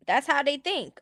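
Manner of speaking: normal talking voice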